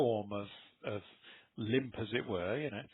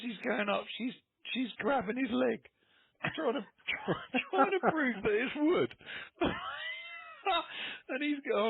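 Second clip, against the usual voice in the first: laughing; 12 semitones higher than usual. Real, not acted. Cracking up telling funny story.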